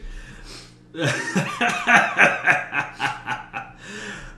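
big burst of real laughter; loud laugh. Real, not acted. Laughs at player joke.

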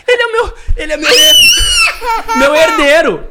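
high, loud, laughing cry; excited laughing shout. Real, not acted. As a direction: shout it out with joy. Mother proudly announcing her heir.

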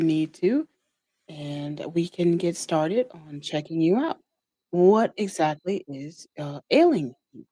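put-on slow, drawn-out country accent; a hint of a country accent, slowed. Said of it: "I'm trying to give her, like, real country".

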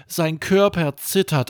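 plain reading voice

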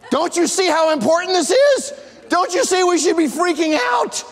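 high, loud, panicked yell; hammed-up alarm at a calm friend; frantic worried shout. Mocking a worrier who is mad you're calm, shouting high. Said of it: "they're mad at you because you're not worried too".

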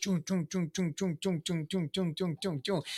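repeated chung sound effect in even beats; steady run of identical mimicked thunks; rhythmic imitated noise. Imitates thoughts flashing through his mind.

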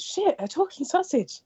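high squeaky joke voice; silly sausage voice. Acted. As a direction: pitch it way up for the punchline.

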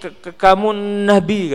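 stuttering in mock fright; a frightened worker, held at knifepoint, stammering his answer; mock scared stammer. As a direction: stammer out the yes like a terrified gardener.

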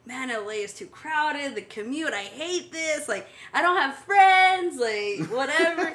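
frustrated rant. Retold or imitated. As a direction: vent it, building to the top. Venting frustration about LA.

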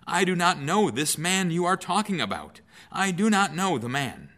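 high, loud, swearing denial; desperate, cornered swearing; cursing denial. Acted. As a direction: curse as you deny him, voice up high. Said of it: "began to curse and to swear".

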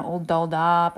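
ordinary talk

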